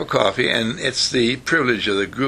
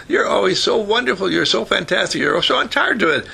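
higher-pitched acted motherly flattery; gushing praise; 7 semitones higher than usual. Mother praising her upset son, acted gushing. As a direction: lightly play a mother building up her boy.

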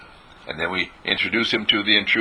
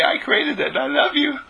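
much higher, tender proud declaration; adoring creator. Pretend. Speaking to the sky as its creator, adoring and proud. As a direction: gush with pride over your creation. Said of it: "you look at the sky and you say".

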